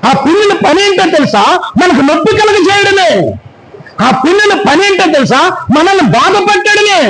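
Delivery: high, rousing pulpit preaching; fiery preaching. Acted. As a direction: preach it to the whole church. Preaches to the congregation. (ఉత్సాహంగా బోధించే స్వరం)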